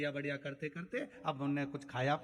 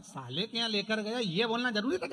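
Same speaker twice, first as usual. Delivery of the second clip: angry; 6 semitones higher, 5 dB louder than usual. Acted. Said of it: "उसको तो गुस्सा आ गया".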